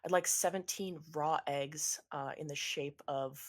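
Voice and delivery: deadpan, trailing low and soft. Acted. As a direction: state the absurd order without any life. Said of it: "an absolutely dead voice goes".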